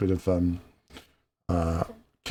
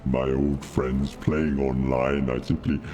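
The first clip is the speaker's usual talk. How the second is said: Darth Vader voice; 8 semitones lower than usual. Acted. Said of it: "little bit of Vader".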